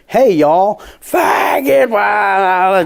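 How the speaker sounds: mock yell of a rowdy heckler. Comic retelling heckler, hammed-up yell from crowd. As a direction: shout it out from the back of the crowd.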